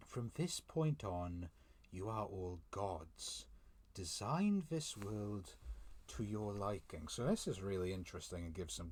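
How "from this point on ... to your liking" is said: slow, grand quote, each phrase weighed; slow solemn decree. Acted. Reader re-quoting Luna's words to viewers, slow and solemn. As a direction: reread it slowly like a goddess's decree. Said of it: "very interesting what Luna's saying here".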